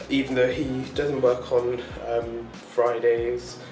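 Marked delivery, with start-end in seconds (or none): none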